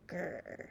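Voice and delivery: low little grr; a grumpy growl in the throat. Acted. The sour grape grumbling to himself, low growl.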